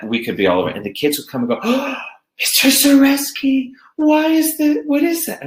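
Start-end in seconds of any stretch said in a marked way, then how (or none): 1.6-5.4 put-on high, excited child voice; kids' voices. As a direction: mimic curious little kids, high up. Kids voiced asking about web.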